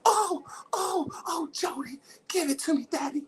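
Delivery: high breathy mock moans of a woman; mock moaning woman. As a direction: ham up a woman moaning for Jody.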